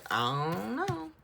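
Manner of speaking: high, loud, drawn-out 'I don't know'; big theatrical shrug in the voice; exaggerated cluelessness. Real, not acted. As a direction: stretch it out and send the pitch soaring. Playful guessing, exaggerated first take.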